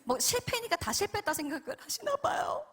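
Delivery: high, whimpering mock hurt; playful tearful pout; mock-tearful complaint. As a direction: feign being hurt, voice squeezed up high.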